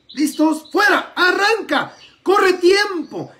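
shouted, high race countdown; excited race start call. Acted.

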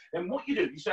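ordinary delivery

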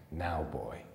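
low, quiet, cold threat; a lightly voiced murderer closing in; soft menace over a trapped boy; menacing killer. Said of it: "said the man Jack".

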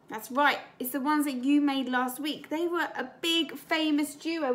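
usual speaking voice

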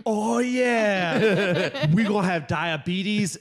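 high, giddy ranting promise; hammed-up overexcited dad. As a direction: pile it on with mounting comic glee. Hyped dad promising endless movie binge.

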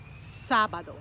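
"sábado" punched loud on "sá"; first-syllable stress. Shown as a demonstration. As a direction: hit the accented A in "sábado". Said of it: "that's the syllable we're going to emphasize".

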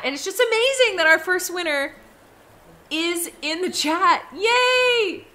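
high, loud, happy cheer; real excitement. Real, not acted. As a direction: build to a joyful yay. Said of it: "I'm really excited".

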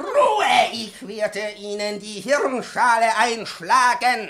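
high, loud, slow menacing yell; furious shout. Acted. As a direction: roar the threat at her. Professor threatens the pupil.

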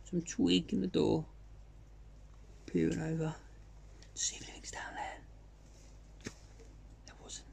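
drops to a whisper while peering out; creeping out of the bivvy, hushed whisper. Retold or imitated. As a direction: whisper it like you're sneaking a look.

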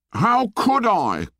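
put-on high, slow, protesting boy; indignant cry. A narrator's reading. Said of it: "cried Piggy indignantly".

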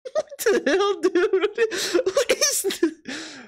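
high, laughing what the hell; laughing disbelief. Real, not acted. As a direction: say it again, now cracking up. Reacting to a drawing.

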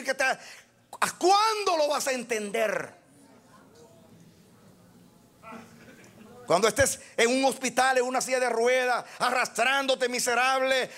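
a fired-up preacher pleading with the church; fiery preaching. Real, not acted. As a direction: raise the voice and drive each question home. Preaching at full force.

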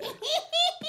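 high, manic cackle of laughter; mad cackle. A narrator's reading. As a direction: cackle like a madman. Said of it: "Morphin let out a mad cackle".

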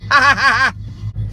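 loud open ha-ha-ha laugh; burst of laughter. Real, not acted. Mocking Scotty's Ram video, loud laughing burst. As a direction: laugh out loud, big and sudden. Laughs at his own joke.

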